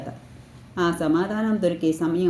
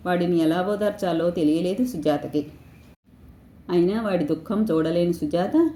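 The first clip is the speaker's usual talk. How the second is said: plain reading voice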